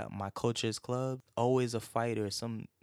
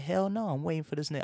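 defiant refusal to leave; 7 semitones higher, faster than usual. Retold or imitated. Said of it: "I was like".

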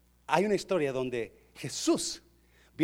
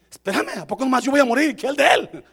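high, loud, quick objection; hammed-up indignant protest; acted protest; 7 semitones higher, 8 dB louder than usual. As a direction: blurt it out as a comic complaint. Acts Peter protesting to Jesus.

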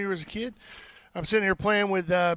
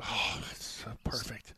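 whispering; 10 semitones lower, 13 dB quieter, slower than usual. Real, not acted. Savoring bacon, hushed aside.

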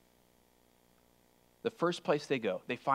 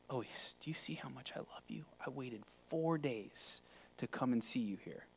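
breathy whispered, smug husband; mock proud whisper; 13 dB quieter than usual. Mock proud husband to hurt wife.

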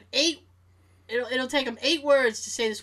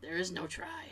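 hushed, lower Yoda quote; 5 semitones lower, 14 dB quieter than usual. Acted. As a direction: play along softly with the Yoda bit. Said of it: "not in that weird voice".

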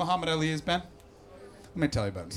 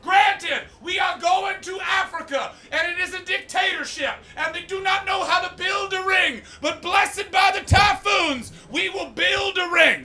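very high, loud, slow preacher-promoter shout; slow, high, booming fight-promoter sermon; Don King impression; 6 dB louder, slower than usual. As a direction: boom like a hype-man promoter. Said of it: "he talks like this".

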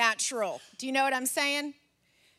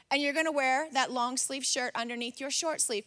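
ordinary talk

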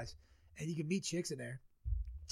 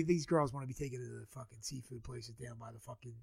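ordinary talk, nothing marked

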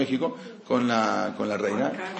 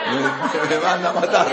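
laughing through the words; chuckles out the punchline; 2 semitones higher than usual. Real, not acted. Rabbi jokes to the congregation, laughing. Joking about the king's reaction.

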